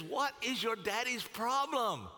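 high, drawn-out exasperated question; indignant demand. Acted. David runs to Jonathan about his daddy, indignant.

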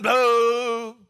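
long, loud drawn-out boo; imitated crowd booing. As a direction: boo like a fan caught up in the game. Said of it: "You find yourself booing".

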